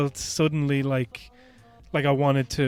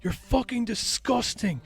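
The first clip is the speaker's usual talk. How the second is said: retold yell; 8 semitones higher, slower than usual. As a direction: replay her outrage in a raised pitch, slowly. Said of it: "she's, like, literally yelling".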